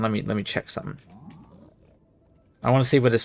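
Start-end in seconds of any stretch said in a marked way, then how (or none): 0.0-1.0 quieter, under the breath; muttered. Real, not acted. Streamer muttering while checking game. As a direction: mutter it to yourself.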